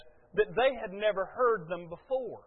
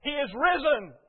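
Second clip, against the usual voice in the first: higher, louder Easter proclamation; bright-eyed, raised-voice announcement; wife's morning greeting; 6 semitones higher, 5 dB louder than usual. Retold or imitated. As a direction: sing out the good news as you pass. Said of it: "she walked past me and she said".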